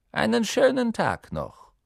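high, falling singsong farewell; sarcastically sweet goodbye; mock politeness. A narrator's reading. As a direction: sign off with false courtesy. Mock politeness after a threat.

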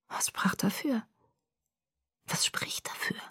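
breathy whisper, barely voiced; whispered murmur. A narrator's reading. Edward murmuring to Bella, whispered. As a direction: murmur it to yourself. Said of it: "Murmelte er".

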